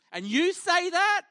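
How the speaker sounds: high, loud, slow disbelief; outraged. Retold or imitated. The friends, furious at Jesus forgiving sins, high and loud. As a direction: stretch it out in outrage.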